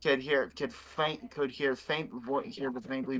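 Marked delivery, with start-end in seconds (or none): none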